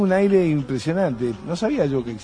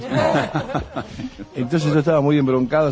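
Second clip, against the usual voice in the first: ordinary delivery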